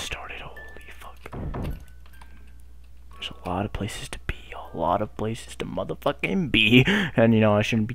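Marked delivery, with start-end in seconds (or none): 0.0-1.3 whispered awe at the game; hushed whisper. Real, not acted. Calming himself, then awed. 3.1-4.3 soft whisper, almost voiceless; whispered first take; whispered awe. Real, not acted. Awed at the map.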